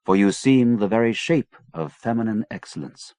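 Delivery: plain reading voice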